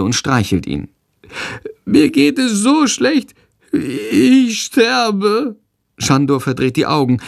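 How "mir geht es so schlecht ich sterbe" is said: very high, slow, suffering whine; sick creature wailing. A narrator's reading. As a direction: lament like a dying little animal. Sick bat wailing that it dies. (leidend, sehr hoch jammernd)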